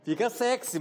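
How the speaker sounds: "Fica sexy" said with exaggerated nasalized vowel; overdone nasal I. Shown as a demonstration. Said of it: "mas tá errado isso". (pronúncia exagerada e sensual)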